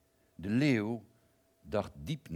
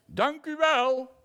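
very high, loud, slow shout of thanks; called out louder; 11 dB louder, slower than usual. Acted. Lion calls thanks from afar, very high and loud. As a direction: bellow it from far away like a roar. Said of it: "riep hij het nog een keer".

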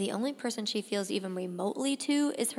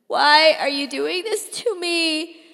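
loud, higher accusing question; hurt and betrayed outburst; anguished plea; 6 semitones higher, 10 dB louder than usual. A narrator's reading. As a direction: cry it out at your father. Teen pleading with father while dragged.

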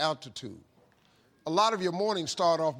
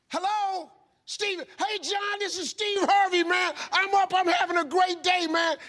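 much higher and louder, fully energized; eager wake-up answer; 12 semitones higher, 6 dB louder than usual. Retold or imitated. Steve answering John's call before dawn, wide awake and pumped. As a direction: beat the caller to the punch with joy.

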